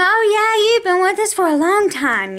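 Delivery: lightly voiced cheerful clerk; mimicking the clerk. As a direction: play the clerk suddenly all friendly. Retelling the clerk's friendly remark.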